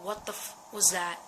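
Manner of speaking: ordinary talk, nothing marked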